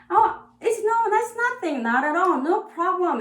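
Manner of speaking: high bright burst of reassurance; high-pitched, eager no-problems; excited. Real, not acted. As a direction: toss them out high and eager. Lively English equivalents.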